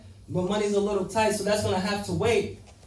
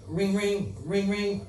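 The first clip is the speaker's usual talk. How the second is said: phone ring imitation; 4 dB quieter, much slower than usual. Phone ringing in the story.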